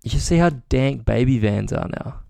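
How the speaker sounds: normal talking voice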